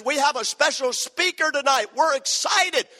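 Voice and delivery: high, hyped-up host introduction; imitating an eager emcee; excited announcer. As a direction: introduce the speaker with mock enthusiasm. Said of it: "They were the only ones excited".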